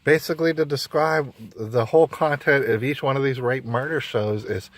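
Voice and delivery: normal talking voice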